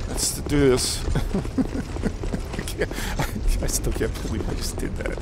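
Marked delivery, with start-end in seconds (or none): 2.6-5.2 whispered disbelief. Real, not acted. Muttering in disbelief.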